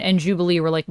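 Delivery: ordinary talk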